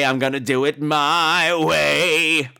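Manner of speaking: ordinary talk, nothing marked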